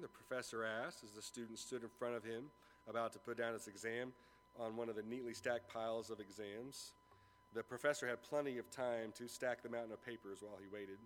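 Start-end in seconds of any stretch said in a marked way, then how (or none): none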